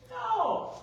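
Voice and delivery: loud, high exclaimed no; emphatic denial. Real, not acted. Speaker denying it happened overnight, loud emphatic denial. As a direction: shoot the idea down, high and loud. Answers own rhetorical question emphatically.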